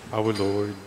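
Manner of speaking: usual speaking voice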